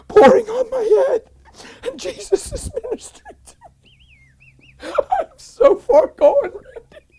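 high voice breaking into crying laughter; real joyful weeping while testifying; overcome, tearful. Real, not acted. Preacher reliving Jesus ministering to him, tearfully overwhelmed.

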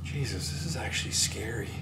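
hushed, scared whisper. Real, not acted. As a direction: whisper so nothing hears you. Whispering in the dark mill.

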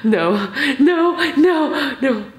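laughing high no's. Real, not acted. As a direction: laugh while refusing. Laughing refusal.

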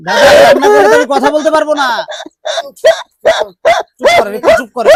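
high, loud pleading to stop; loud plea. Real, not acted. Shouting gently to calm the crying father, high and loud. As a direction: raise your voice over his crying.

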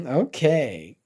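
ordinary delivery, nothing marked

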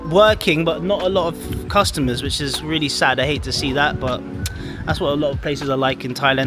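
ordinary talk, nothing marked

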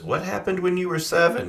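lightly voiced therapist asking; counselor voice. Imitated counselor asking a question.

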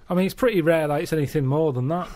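ordinary talk

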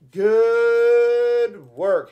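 loud, high, long-held "Good"; drawn-out cheer. Real, not acted. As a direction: stretch GOOD into a big cheer. Repeats praise, stretching it out.

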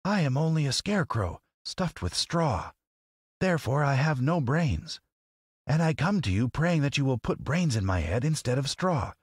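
high, earnest scarecrow voice; brave scarecrow. A narrator's reading. Scarecrow petitioning the lady for brains, brave. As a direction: make a brave, humble petition, pitched high. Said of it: "he answered her bravely".

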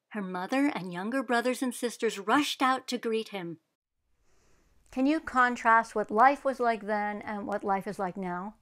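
ordinary delivery, nothing marked

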